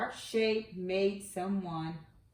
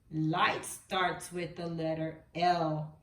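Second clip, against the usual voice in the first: normal talking voice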